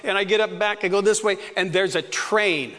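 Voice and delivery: ordinary delivery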